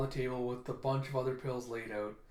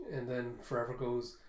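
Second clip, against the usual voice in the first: ordinary delivery, nothing marked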